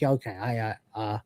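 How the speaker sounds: low, soft sheepish mutter; quietly mortified, stumbling over the words; embarrassed realisation. Retold or imitated. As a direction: shrink into a low, hesitant mumble. Voicing Crichton's embarrassed realisation.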